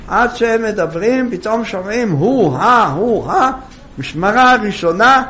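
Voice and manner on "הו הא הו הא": hee-haw bray imitation; donkey bray. Donkey braying as it comes home.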